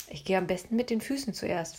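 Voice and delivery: ordinary delivery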